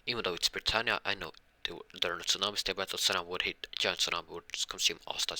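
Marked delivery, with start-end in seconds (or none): none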